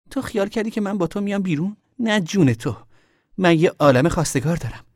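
high, loud scornful outburst; angry taunt. A narrator's reading. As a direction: put him down at full pitch. Girl taunts him in quarrel.

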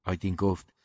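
ordinary delivery, nothing marked

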